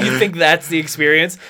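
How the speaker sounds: ordinary delivery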